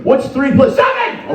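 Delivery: high, loud, slow quiz question; imitated classroom teacher. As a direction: act out a teacher quizzing the class. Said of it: "Teachers must have hated you".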